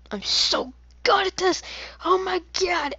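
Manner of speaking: very high, loud sarcastic brag; high mocking voice of fake pride; squeaky mock boast. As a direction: squeal it like a cartoon. Sarcastic squeaky self praise.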